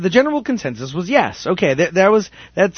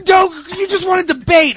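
high-pitched retold rant; frustrated yelling; 10 semitones higher than usual. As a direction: re-enact losing your temper in an argument. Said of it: "I just start yelling".

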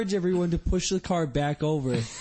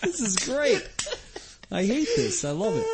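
laughing; 12 semitones higher than usual. Real, not acted. Laughing hard at the crash story.

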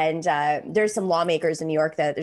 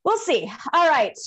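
ordinary talk

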